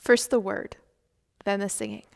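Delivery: ordinary talk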